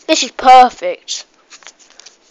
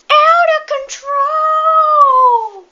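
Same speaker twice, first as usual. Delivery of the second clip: "Out of control" stretched very slowly and pitched far higher; drawn out; 12 semitones higher, 3 dB louder, much slower than usual. Acted. Kid repeating the joke reaction, drawn out high and slow. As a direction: sing "Out of control" out long and high.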